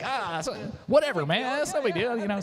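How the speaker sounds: high-pitched dismissive imitation; Ty brushing it off. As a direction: imitate a friend waving away thanks. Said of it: "You know, Ty, he's just like".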